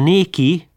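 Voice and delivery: néki with a long é, stressed high and loud; néki hangsúlyosan, hosszú é-vel; dialect néki. Shown as a demonstration. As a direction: say néki, stretching the é. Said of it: "nem neki, néki".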